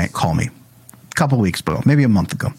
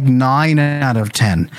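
ordinary talk, nothing marked

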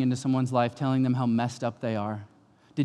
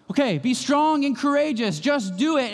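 lightly hammed-up, higher self-encouragement; mock self-pep talk; 10 semitones higher than usual. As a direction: parody the just-do-it attitude. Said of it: "mocking self-talk".

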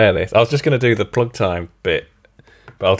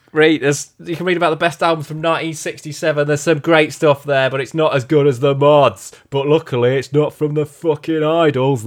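put-on sweary rant, slightly raised; 2 semitones higher than usual. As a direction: rattle off insults as a ranting frontman. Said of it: "do it in a [name]".

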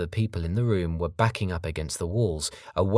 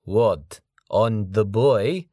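menacing foreign thug; 3 semitones higher, 6 dB louder, much slower than usual. Acted. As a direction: sneer it like a big brute. Sergei scornful.

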